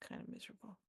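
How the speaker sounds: hushed, near-whispered admission; reluctant confession. Acted. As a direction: let it out very softly, haltingly.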